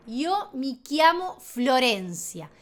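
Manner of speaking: "Yo mi chiamo Florencia" slowly, word by word; slow Italian pronunciation. Shown as a demonstration. Teacher drilling self-introduction, slow "chiamo" with k. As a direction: say "chiamo" as kya-mo. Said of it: "todos los ejemplos sin interrupciones".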